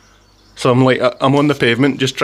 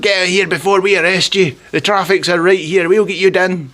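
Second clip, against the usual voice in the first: high-pitched mock police scolding; shouting policewoman; 7 semitones higher than usual. Tiny policewoman ordering a rider off his bike, mock shouting. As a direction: imitate an officer yelling across the road. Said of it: "she's like".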